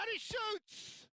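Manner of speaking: high, drawn-out shot call; excited shout. Acted. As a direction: yell it as the ball flies. Shot called in match commentary.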